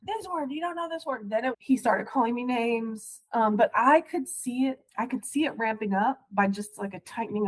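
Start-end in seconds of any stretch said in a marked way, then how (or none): 0.0-1.3 mock yell.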